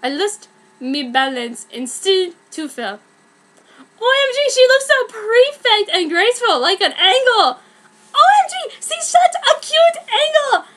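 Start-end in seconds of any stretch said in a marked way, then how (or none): 4.0-10.6 gushing onlooker. Acted. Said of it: "I heard someone say".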